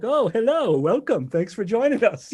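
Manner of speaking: [name]'s mock welcome. Conductor greeting the late guitar sub with a mock-polite, laughing welcome. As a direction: greet the latecomer with a sarcastic host's welcome. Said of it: "[name] looks down like".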